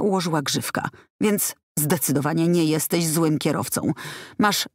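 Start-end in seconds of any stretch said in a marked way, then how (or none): none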